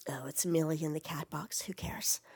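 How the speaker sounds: low-volume, half-whispered aside to self; muttered aside. Real, not acted. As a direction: drop right down, as if talking to yourself. Aside on checking a noise.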